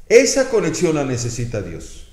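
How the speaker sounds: usual speaking voice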